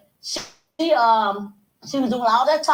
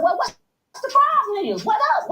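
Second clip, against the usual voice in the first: mock challenge; 9 semitones higher than usual. Retold challenge to the woman.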